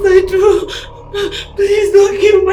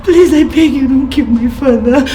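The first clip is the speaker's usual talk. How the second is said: tearful pleading; 3 semitones lower than usual. Acted. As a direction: beg through tears for his life. Begs kidnapper on phone.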